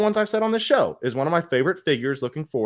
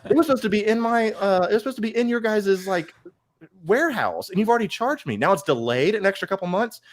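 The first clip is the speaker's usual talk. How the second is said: retold outrage; 8 semitones higher than usual. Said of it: "I'm like".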